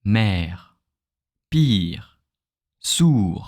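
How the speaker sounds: mère, pire, sourd with a breathy, H-like final R; French R demo. Shown as a demonstration.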